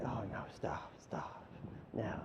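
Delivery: whispered coy refusal; mock whisper. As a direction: whisper it like shy fake modesty.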